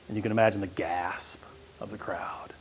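hushed whisper. A narrator's reading. Preacher imagining the crowd's gasp, whispered. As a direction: breathe it like an awed hush.

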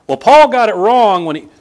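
preacher parodying those who would second-guess Paul; mocking critic voice. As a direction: lift the voice to mock a critic's objection. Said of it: "other people come along behind me and say".

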